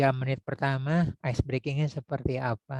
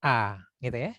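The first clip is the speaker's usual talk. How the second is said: slow, thick O and A from the chest; chest voice vowels; slower than usual. Shown as a demonstration. Teacher demonstrates chest-voice vowels for an authoritative tone. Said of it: "suara di dada dan intonasinya banyak kan tebal".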